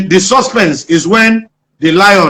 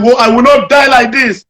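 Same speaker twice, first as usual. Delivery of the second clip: a man hyping himself against a lion; determined vow; 7 semitones higher than usual. Retold or imitated. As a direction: psych yourself up to fight the lion. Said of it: "He now made up his mind".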